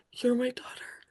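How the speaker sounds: whisper. Retold or imitated. God's voice retold in vision.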